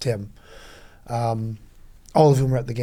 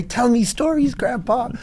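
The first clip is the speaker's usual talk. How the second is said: put-on childlike plea; 12 semitones higher, 4 dB louder, slower than usual. Mock child asking grandpa.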